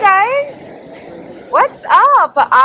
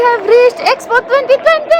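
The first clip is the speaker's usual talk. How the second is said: excited announcement; 10 semitones higher, 4 dB louder than usual. Real, not acted. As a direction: cheer it out as you arrive. Arrival announcement over music.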